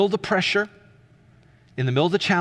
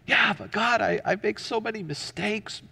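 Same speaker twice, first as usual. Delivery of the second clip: higher, hesitant objection to God; protesting believer; 6 semitones higher, 4 dB quieter than usual. Acted. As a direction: plead your unworthiness, pitched up. Voices a doubting believer answering God.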